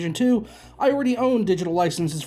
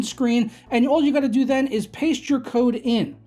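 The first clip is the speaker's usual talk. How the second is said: upbeat, higher sponsor read voice; 5 semitones higher than usual. Acted. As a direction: sell it like a radio ad.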